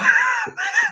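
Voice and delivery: short burst of high laughter. Real, not acted. Recalling giggles at his lion photos, bursting into laughter. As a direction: break off mid-story to laugh.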